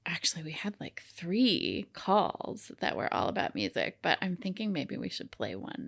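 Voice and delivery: hushed, breathy whisper of a secret; whispering an aside to her cohost. Real, not acted. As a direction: drop to a whisper as if letting them in on it. Aside about calls before playing.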